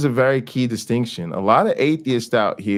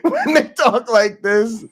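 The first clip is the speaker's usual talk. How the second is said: high giggle breaking through the words; real giggle, pitched way up; giggling; 12 semitones higher than usual. Real, not acted. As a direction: laugh while asking, barely holding it in. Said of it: "Am I so wrong for giggling".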